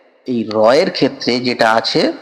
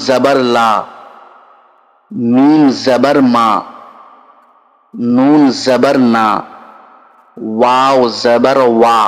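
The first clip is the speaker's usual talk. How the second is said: slow, drawn-out chanting of letters for pupils; teacher reciting the alphabet slowly to children, syllable by syllable; slow letter recitation; 2 semitones lower, slower than usual. Shown as a demonstration. Students learning Arabic letters, slow drill recitation.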